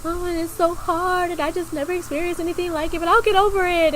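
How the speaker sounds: high, teary, breaking-down tone; a hint of tearful venting; upset. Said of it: "kinda let it all out".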